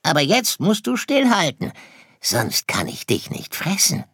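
high, insistent fox voice; polite predator about to eat; hungry fox. Acted. Fox prepares to eat Sirius. (hungriger Fuchs, hohe Stimme)

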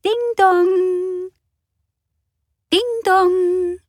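high sung doorbell chime; doorbell ding dong. Acted. Narrator imitating the front doorbell, high sung chime.

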